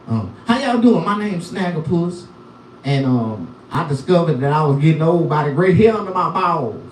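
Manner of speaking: ordinary delivery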